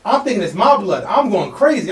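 ordinary talk, nothing marked

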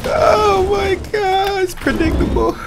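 very high falsetto mockery; slow, sky-high laughing jeer; squealed taunt. Real, not acted. Laughing trash talk in squeal.

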